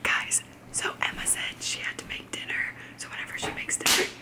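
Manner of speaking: hushed unvoiced whisper. Real, not acted. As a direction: keep it secret, whisper. Hiding, whispering to camera.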